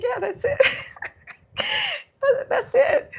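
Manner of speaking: high, squeaky laughing voice. Real, not acted. Parent cracking up over the roller coaster story, laughing hard. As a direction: crack up while saying it, pitch shooting up.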